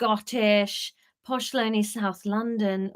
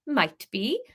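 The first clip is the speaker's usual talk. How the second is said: "Might be" sung with a curling rise; teasing Welsh rise; bendy Welsh lilt; 4 semitones higher than usual. Acted. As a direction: bend "Might be" round a corner, Welsh. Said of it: "bending all the syllables round a corner".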